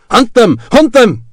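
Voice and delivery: loud, high rallying call; called order. A narrator's reading. Officer sending his sepoys after the garrison, loud call. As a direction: urge the men on. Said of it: "the officer shouted".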